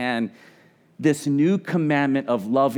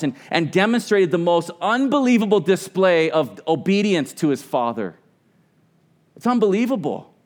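rising fervent awe; 5 semitones higher, 4 dB louder than usual. Real, not acted. Preacher swells in awe.

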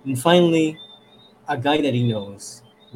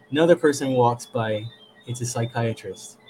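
ordinary talk

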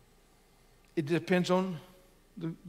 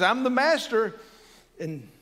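commanding; 6 semitones higher, 3 dB louder than usual. Acted. Voicing the Lord's stern command.

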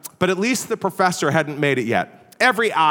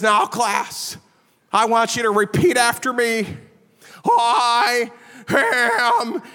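mock squeaky, high-pitched little lecturer; high squeaky professor; 5 semitones higher, much slower than usual. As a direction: squeak it out like a scrawny, odd professor. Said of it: "he had this high squeaky voice".

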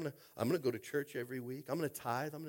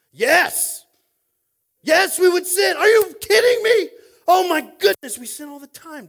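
shouted, higher, laughing exclamation; preaching at full force; 13 semitones higher, 21 dB louder, slower than usual. Acted. Pastor shouts back to the congregation, laughing outburst. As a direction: bellow it with delighted disbelief.

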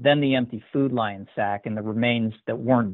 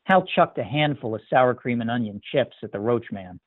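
plain reading voice

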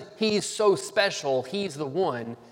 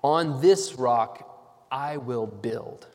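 slow, with weight on "this" and "I" in "On this rock I will build."; pronouns stressed; 3 semitones lower, much slower than usual. Shown as a demonstration. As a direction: lean on "this" and "I", slowly. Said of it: "notice the pronouns in this next phrase".

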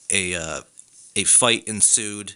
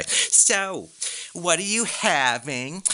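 nosy dinner friend; 8 semitones higher, much slower than usual. Retold or imitated. Mimicking nosy dining companions.

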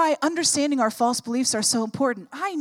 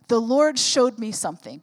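ordinary talk, nothing marked

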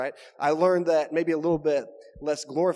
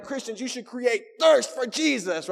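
high, raised pulpit cry, "thirst" punched; preaching; 8 semitones higher, 4 dB louder than usual. Acted. Preacher demonstrating a possible sermon point, preaching it high. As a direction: belt it out like a rousing sermon line. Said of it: "Man, you can preach on those".

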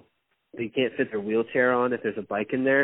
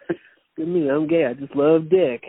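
hammed-up loud brag, pitched up; mocking himself at the party, hammed-up and loud; 5 semitones higher, 6 dB louder than usual. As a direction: play the oblivious joker flaunting it. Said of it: "and you're like".

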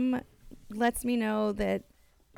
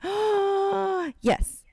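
thrilled gasp; 10 semitones higher than usual. Retold or imitated.

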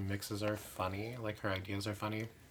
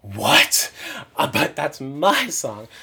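outraged squeak of disbelief, slowing on my song; spring up high and loud in offended shock; shocked what; 6 semitones higher, 14 dB louder, much slower than usual. Retold or imitated. Backstage, hearing a rival sing her song, squealing in outrage. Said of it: "and I was like".

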